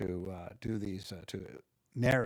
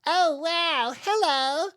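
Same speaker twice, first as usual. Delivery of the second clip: much higher and louder squeaky hello; tiny creature's delighted greeting; high little character voice; 9 dB louder, much slower than usual. Shown as a demonstration. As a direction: pipe up like a tiny excited critter. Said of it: "such a contrast from".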